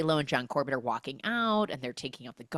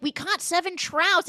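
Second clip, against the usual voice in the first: high, loud boast; mock excited announcement; 13 semitones higher, 5 dB louder than usual. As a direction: announce it with hammed-up glee. Retold returning campers' excited announcement.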